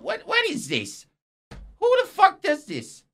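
high-pitched, incredulous squawk; baffled disbelief. Acted. Presenter can't understand faces on cars, incredulous. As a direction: question it in utter bafflement.

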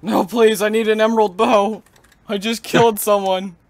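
high, loud eager begging; excited pleading. Real, not acted. Begging teammate for an emerald bow.

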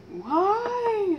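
exasperated. Real, not acted. Exasperated at wasteful bakers.

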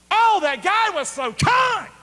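high, loud mock praise; sarcastic high shout of praise; mock exclaim. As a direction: throw it up high, mocking. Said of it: "no one stands out".

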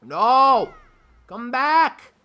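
high, loud pleading; desperate yell. Real, not acted. Gamer begging it to come back, desperate yell. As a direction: yell after it in despair. Object leaves again.

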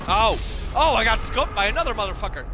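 loud, high cry of surprise; startled outburst. Real, not acted. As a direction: yell out as you get hit unexpectedly. Suddenly shot during the game.

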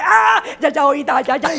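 mock toddler shriek; screaming toddler. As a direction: wail like a child whose toy is taken. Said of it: "grita muy fuerte".

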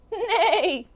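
high, wavering horse whinny; whinny it high, falling at the end; cow's neigh. A narrator's reading. Storyteller recapping what the farmer heard, high neigh. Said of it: "The cow said".

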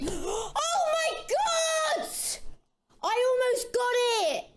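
high shrieking near-miss excitement; excited shriek. Real, not acted. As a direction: squeal it out like you nearly won.

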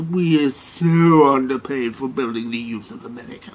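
whiny mocking voice of a complaining teacher; a wall street trader mocking teachers' pay complaints, whining; mock whine. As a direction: mimic someone griping about being underpaid. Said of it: "and whine like".

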